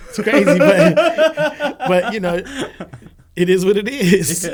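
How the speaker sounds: loud, high laughing talk. Real, not acted. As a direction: laugh through the words. Laughing about it.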